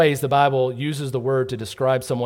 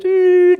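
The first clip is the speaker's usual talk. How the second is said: loud, high imitated whistle doot; whistle blast; 11 dB louder than usual. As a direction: blow the break whistle with your voice. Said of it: "it goes".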